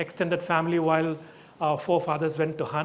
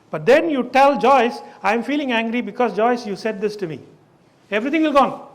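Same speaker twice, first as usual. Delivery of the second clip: emphatic excitement; 4 semitones higher, 7 dB louder than usual. Real, not acted. Excited point to listener.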